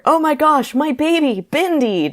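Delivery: high-pitched, gushing exclamation; mock-ecstatic dad, badly faked German; exclaimed, German dad. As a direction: cry out in hammed-up joy. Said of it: "exclaimed in his German accent".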